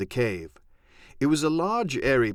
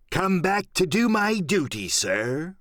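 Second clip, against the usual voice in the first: put-on higher, dutiful old sailor; humble pirate; 6 semitones higher than usual. Silver answering the captain, mock humble. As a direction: feign loyal duty.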